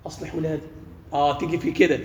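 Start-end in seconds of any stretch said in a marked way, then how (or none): 0.1-0.7 softer, lower plea to God; a father softly praying for his children; quiet prayer. Retold or imitated. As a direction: murmur it like a private prayer. Acting out a parent's prayer.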